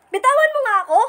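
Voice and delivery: yank free and snap at him; angry snap. Acted. Angry at her friend for grabbing her arm.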